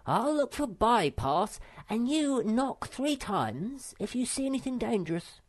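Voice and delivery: high, bossy little creature giving orders; bossy little Sniff. Acted. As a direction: play the self-important path pioneer, pitched high. Sniff voiced in story.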